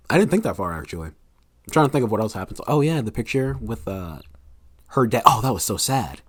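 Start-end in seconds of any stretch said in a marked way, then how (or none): none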